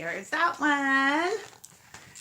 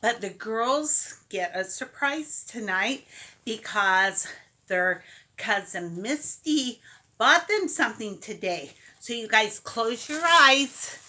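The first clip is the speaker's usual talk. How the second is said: ordinary delivery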